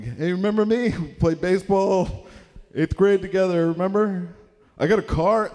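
high, loud overeager phone voice; eager fake cheer. Teen cold-calling old classmates, overeager cheery pitch. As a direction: sell yourself too hard.

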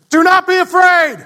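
barked, high and loud; shouted command. Retold or imitated. Father yelling an order at a frightened kid, loud and high. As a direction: yell it like a harsh order. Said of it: "you raised your voice and gave me another command".

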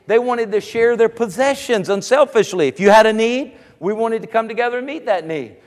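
rising preacher's cadence, building in force; preaching. Acted. Pastor to his congregation, preaching with rising fervor. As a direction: preach it out to the pews.

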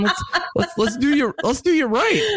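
laughing. Real, not acted. Laughs while retelling.

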